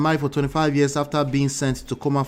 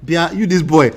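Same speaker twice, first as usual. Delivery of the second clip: loud, higher mock scolding between laughs; pretend stern auntie; 5 semitones higher, 8 dB louder than usual. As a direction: bark it at the boy like a fed-up auntie, cracking up. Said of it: "I wish the auntie pinned him down and said".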